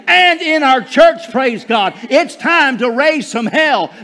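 full-force preaching. Real, not acted. Preacher urging congregation to tear down hell's kingdom, full-force preaching. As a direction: preach it with rising fervor.

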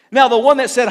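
normal talking voice

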